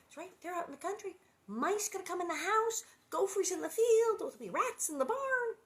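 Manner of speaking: high-pitched rising list of woes; mock alarm. Acting out an alarmed list of pests.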